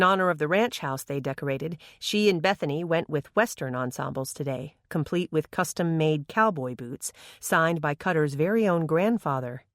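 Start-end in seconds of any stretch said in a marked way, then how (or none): none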